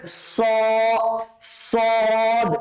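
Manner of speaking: স and সদ with heavy s and long rounded vowel; thick sad. Shown as a demonstration. Teacher shows students the thick letter, heavy সদ. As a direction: hold স, then সদ, thickly. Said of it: "মোটা করে".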